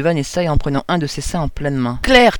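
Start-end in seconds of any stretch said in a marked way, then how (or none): 2.0-2.4 a booming rebuke, much higher and louder; thundering. Acted. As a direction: roar her name to stop her. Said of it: "tonna le prélat".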